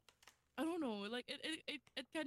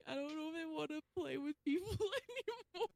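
scared, laughing; 7 semitones higher, faster than usual. Retold or imitated. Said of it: "'cause I was so scared".

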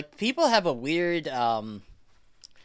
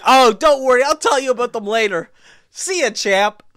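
louder, higher cheerful mock reassurance; imitating loud stranger; 7 semitones higher, 7 dB louder than usual. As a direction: brush her off loudly and cheerily. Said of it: "he's like".

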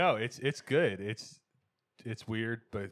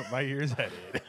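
laughing; 5 semitones higher, 6 dB louder than usual. Real, not acted. Laughs mid-sentence at own joke.

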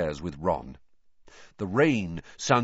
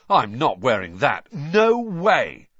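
louder, rising into no way; loud refusal; 5 semitones higher, 7 dB louder, slower than usual. A narrator's reading. Boy refusing his mother's robes, loud defiant voice. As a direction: refuse it outright, getting louder.

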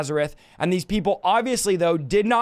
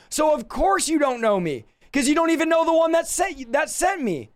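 higher-pitched, insistent acted protest; exasperated rebuke; 8 semitones higher than usual. Jesus to the Pharisees who don't know him, exasperated. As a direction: press the point in a raised pitch.

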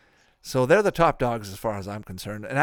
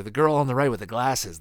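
usual speaking voice

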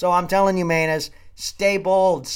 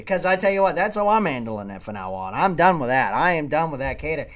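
ordinary talk

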